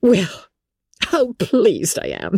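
very high, squealing matron's delight; rapturous. A narrator's reading. Wife thanking husband for visiting Bingley, rapturous. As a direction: gush ecstatically at the top of your range. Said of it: "fatigued with the raptures of his wife".

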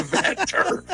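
words breaking into laughter; laughing. Real, not acted. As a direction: laugh through the last words. Laughing at the horse story.

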